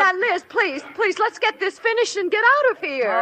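high-pitched, anxious plea; urgent pleading. Acted. Friend begging Liz to finish, pleading. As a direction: beg her to drop it and go.

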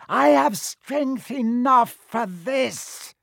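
very high, slow, loud villain voice. A narrator's reading. Hidden master overrules his servant, high and forceful. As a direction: insist in a drawn-out eerie high voice. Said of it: "The high voice spoke again".

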